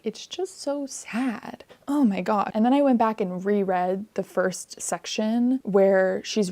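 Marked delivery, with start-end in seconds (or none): 0.0-1.6 higher, slow, softer lament; softer sad sigh. Real, not acted. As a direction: let it fade into quiet sorrow. Repeating her sadness over the ending.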